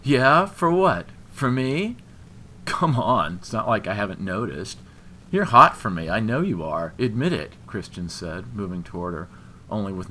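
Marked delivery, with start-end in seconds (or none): 0.0-7.5 loud, swaggering challenge; cocky goading. A narrator's reading. Drunk man insisting she wants him, cocky goading. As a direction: press her loudly, punching each phrase.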